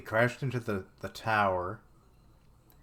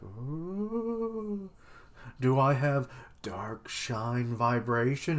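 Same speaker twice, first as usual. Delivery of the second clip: high ooh then a slow whispered question; mock whisper; 3 semitones higher, much slower than usual. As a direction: tease him with a breathy hushed mock voice. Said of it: "He's like".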